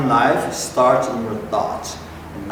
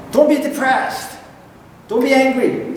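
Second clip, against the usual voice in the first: high scolding order to oneself; self-scolding; 12 semitones higher, 4 dB louder than usual. Retold or imitated. Preacher imitating someone scolding themselves. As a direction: order yourself to stop, up high. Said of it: "You tell yourself".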